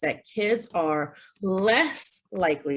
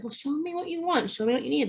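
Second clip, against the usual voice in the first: higher, softer coaxing; gentle prompt; 8 semitones higher, 4 dB quieter, faster than usual. Shown as a demonstration. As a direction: invite the child softly. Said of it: "A lot of kids say".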